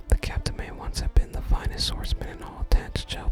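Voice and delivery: normal talking voice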